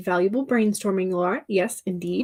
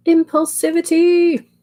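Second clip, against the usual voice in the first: playful exclamation; 9 semitones higher, 7 dB louder, slower than usual. Real, not acted. Jokey exclamation about herself.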